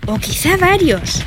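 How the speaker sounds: put-on high childlike aside; eager girl. A narrator's reading. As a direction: toss it in like a gleeful kid.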